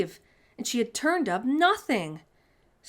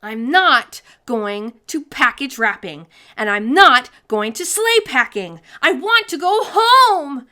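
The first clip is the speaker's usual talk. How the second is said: put-on loud girl's shout rising higher; yelling; 5 semitones higher, 13 dB louder than usual. A narrator's reading. Sophie yelling refusal at Mister Pudding, loud shout. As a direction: shout it, stamping your foot. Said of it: "Sophie yelled".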